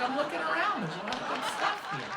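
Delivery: high, tight mom voice through closed teeth; imitated mom's muffled high retort; mom's closed-mouth retort. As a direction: answer back with mouth clamped. Mom answers mouth-closed yell alike.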